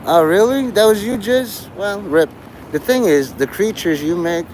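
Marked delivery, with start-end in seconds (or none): 0.1-2.3 mock surprise. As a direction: act impressed, but clearly teasing. Mock reaction to a boast.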